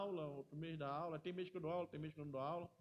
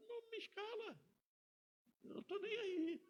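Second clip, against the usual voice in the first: mock retort. Mocking director's curt reply.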